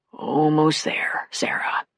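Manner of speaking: slow, breathless whisper of comfort. A narrator's reading. Sam whispers to injured Sarah as he skis down, slow whisper. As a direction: whisper it to her, barely voiced. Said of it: "he whispered".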